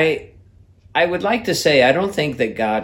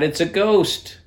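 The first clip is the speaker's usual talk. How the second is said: higher, mock panicked cry; frightened cry; 7 semitones higher than usual. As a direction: cry out as if seeing a ghost. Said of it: "they cried out".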